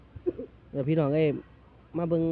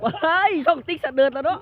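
put-on girly voice; 11 semitones higher, 7 dB louder, slower than usual. Joking shriek while plugging channel.

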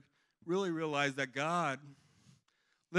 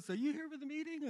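lady asking; 14 semitones higher than usual. Retold or imitated. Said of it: "the lady comes out".

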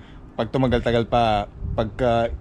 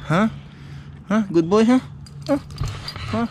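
squeaky praising a dog; 10 semitones higher than usual. Real, not acted.

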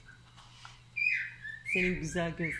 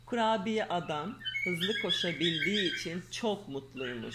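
lively storybook reading voice; reading to children. Acted. Mother reading a fairy tale to her daughter, lively storyteller. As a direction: read the tale aloud to a little girl.

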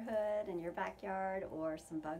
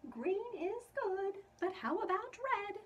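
sweet ladybug; 12 semitones higher, 6 dB louder than usual. A narrator's reading. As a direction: sing it up high like a tiny ladybug. Ladybug voice in picture book.

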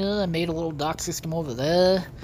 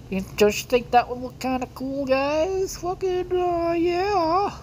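high silly voice; 13 semitones higher than usual. Pretend. Sudden high put-on voice.

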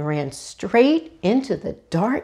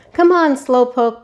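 higher, louder teasing call; 6 semitones higher, 6 dB louder than usual. A narrator's reading. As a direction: call back while running past. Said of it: "Buck called".

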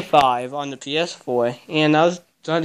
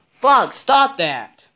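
loud, slow, stretched yell; shout at the cat; 10 semitones higher, 6 dB louder, much slower than usual. Real, not acted. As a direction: snap loudly at the cat to stop. Yelling at cat clawing his foot.

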